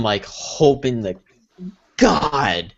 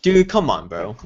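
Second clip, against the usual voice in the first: usual speaking voice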